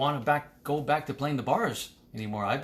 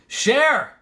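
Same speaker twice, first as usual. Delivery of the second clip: excited sign-off; 7 semitones higher, 10 dB louder than usual. Real, not acted. Loud stream sign-off.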